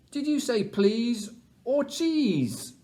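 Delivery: high, slow puzzled question; puzzled otter. Acted. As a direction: ask it high and baffled. Mister Otter confused.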